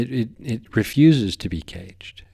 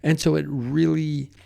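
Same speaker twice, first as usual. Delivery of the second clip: overjoyed; 5 semitones higher than usual. Real, not acted. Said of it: "I'm crying right now practically".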